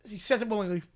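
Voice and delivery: ordinary talk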